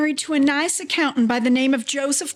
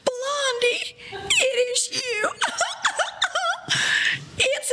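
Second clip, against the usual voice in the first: tearful joy; 10 semitones higher, slower than usual. Acted. Recognizes old friend, overjoyed.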